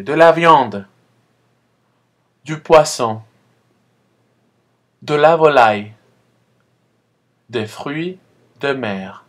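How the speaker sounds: loud, spaced teacher model; model repetition. Shown as a demonstration. Teacher calling out words to repeat, loud and spaced. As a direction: leave a gap after each word for the class. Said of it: "Répétez, s'il vous plaît".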